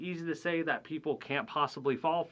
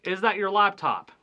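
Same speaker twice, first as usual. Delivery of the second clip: asked up high and loud; a loud quizzing read of the example; read aloud example; 6 semitones higher, 8 dB louder than usual. Shown as a demonstration. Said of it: "All right".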